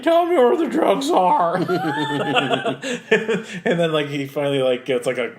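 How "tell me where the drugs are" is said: high, loud, slow strained growl with a laugh; Batman voice. Retold or imitated. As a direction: shout it as an over-the-top Batman. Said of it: "how did Batman find his voice".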